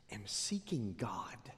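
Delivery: softly whispered reverence; hushed aside. Real, not acted. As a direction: drop to a hush for weight.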